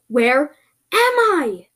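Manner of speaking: slow, loud, rising-pitch demand; shouted demand. Acted. In the creature's face, shouted demand. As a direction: shout it word by word. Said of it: "shouted in their face".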